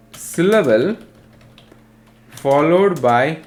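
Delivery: very slow, stretched dictation; piece-by-piece dictation with long pauses; dictating. Real, not acted. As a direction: draw out each phrase and wait for the writers. Teacher dictates definition for note-taking.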